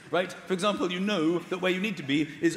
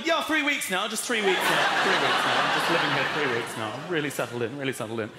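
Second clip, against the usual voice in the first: put-on affected London accent, sinking lower; lost Northern accent; 5 semitones lower than usual. Northerner just arrived in London, affected London accent. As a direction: chatter in a newly adopted London accent. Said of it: "they lose their accent really quickly".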